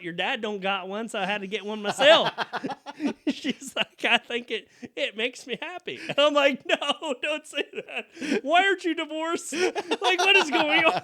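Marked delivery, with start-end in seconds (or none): 0.0-2.3 mom's voice. Retold or imitated. Mom voiced in story. 4.0-6.0 mom's voice. Retold or imitated. Mom voiced in story. 6.7-11.0 high, loud, laughing plea; laughing disbelief. Retold or imitated. His shocked reply to mom.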